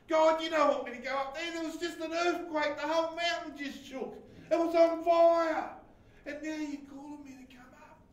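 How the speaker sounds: high, fast, incredulous complaint; protesting Moses. Acted. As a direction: object loudly, as if to God. Acting out Moses protesting to God.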